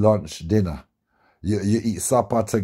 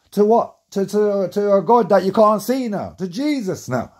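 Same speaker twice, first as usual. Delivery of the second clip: indignant outburst; 10 semitones higher, 3 dB louder than usual. Real, not acted. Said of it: "indignant rhetorical outburst".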